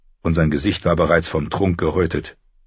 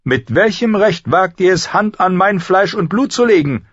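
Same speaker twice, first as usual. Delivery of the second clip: high, loud, haughty challenge; raised, imperious indignation; indignant demand; 13 semitones higher, 4 dB louder than usual. A narrator's reading. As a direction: demand an answer as an outraged queen. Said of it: "verlangte Cersei zu wissen".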